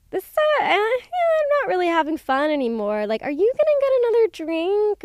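high, loud fake-casual hint to a friend; mock whiny hint. At the party, hinting to a friend that you're bored: high mock whine.

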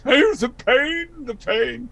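high, whiny drawn-out complaint; Doctor Smith. Retold or imitated. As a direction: moan theatrically like a fussy villain. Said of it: "not Doctor Smith".

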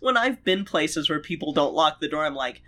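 ordinary talk

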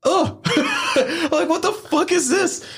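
very high, laughing shock; reacting to a tube sock, squealing with laughter; laughing disbelief. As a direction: crack up in stunned disbelief. Stranger pulls out tube sock.

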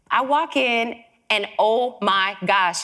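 usual speaking voice

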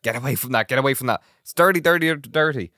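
high-pitched mock parental scolding; imitated screaming parent. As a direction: mimic a panicked parent, pitch climbing on the repeats. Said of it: "would have started screaming at their child".

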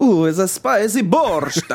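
put-on laughing hammy Ukrainian accent. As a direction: giggle through a Ukrainian accent. Joking Ukrainian voices.

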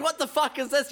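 high-pitched indignant disbelief; hammed-up bewildered outrage; mock outrage. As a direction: play the outraged homeowner, pitch up. Acted son confronts the house squatter.

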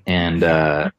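ordinary talk

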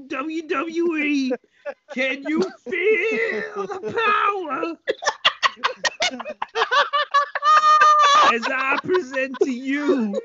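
wrestling promo. Pretend. Announcing the baby, promo style.

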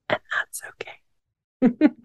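soft whisper, then a voiced okay; whispered reassurance. Real, not acted. Podcaster reassuring listeners, whispered aside. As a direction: whisper it, pausing before the last word.

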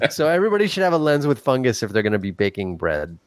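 usual speaking voice

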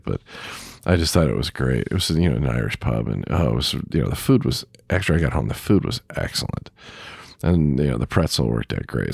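ordinary talk, nothing marked

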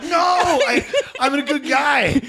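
high, laughing mock plea; mock defensive comic. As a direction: squeak it out while laughing at him. Mocking a comic defending himself.

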